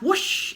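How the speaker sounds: high, loud whoosh; whoosh sound effect. A narrator's reading. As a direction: imitate something rocketing upward. Imitating body shooting upward.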